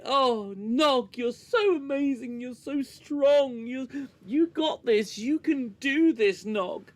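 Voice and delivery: high, loud, over-the-top encouragement; mock gushing cheerleader. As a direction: gush at him like a sappy hugging friend. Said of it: "put the same scene through a New Trek filter".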